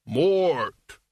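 long drawn-out call of the name; dream cry. A narrator's reading. Mort calls out at the end of a nightmare, drawn-out cry.

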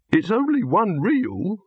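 high, slow, peevish objection; testy. A narrator's reading. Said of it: "muttered Silverfish testily".

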